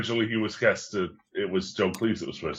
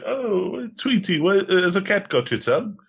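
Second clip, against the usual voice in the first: much higher sing-song coo to a pet bird; baby-talk a dead bird, mock tender; 11 semitones higher than usual. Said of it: "he's like".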